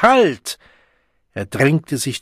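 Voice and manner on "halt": very high, full-force cry; louder ringing shout. A narrator's reading. The Savage halts the handout, shouting with full force. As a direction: shout it at the top of your range. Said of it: "schrie der Wilde laut und schallend".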